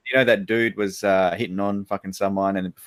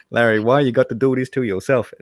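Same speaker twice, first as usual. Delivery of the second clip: ordinary delivery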